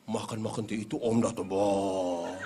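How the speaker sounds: put-on low voice scaring a child into eating; a parent voiced low. A parent scolding a child, voiced low and menacing. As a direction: threaten the little one with a looming uncle.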